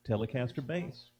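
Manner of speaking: ordinary talk, nothing marked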